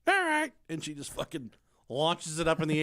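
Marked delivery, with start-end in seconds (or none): 0.1-0.5 put-on high chirpy child voice; high kid voice. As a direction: answer like a cheeky little girl. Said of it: "And she's like".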